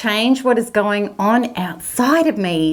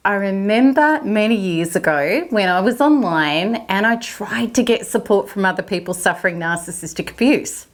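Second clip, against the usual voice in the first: normal talking voice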